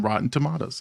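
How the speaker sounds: "Rotten Tomatoes." with "Tomatoes." as "tomahtoes"; British tomahtoes. Shown as a demonstration. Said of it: "And here I am".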